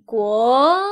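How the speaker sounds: 国 drawn out, pitch gliding upward; second tone. Shown as a demonstration. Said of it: "国 is the second tone".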